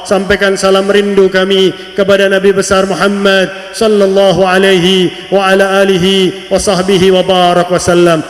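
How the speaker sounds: ordinary talk, nothing marked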